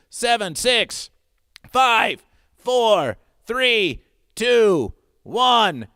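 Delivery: loud dramatic countdown. Acted. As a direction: count it down with rising urgency. Countdown auction timer.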